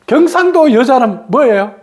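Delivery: ordinary talk, nothing marked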